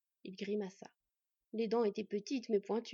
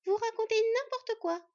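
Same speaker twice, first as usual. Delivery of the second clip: put-on high, loud, drawn-out child's retort; shrill little sister talking back, stretched out; indignant little girl; 12 semitones higher, 8 dB louder than usual. A narrator's reading. Sylvègue answering back to the hunter, indignant little girl. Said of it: "répartit Sylvègue".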